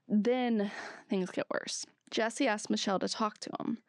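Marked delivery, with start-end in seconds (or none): none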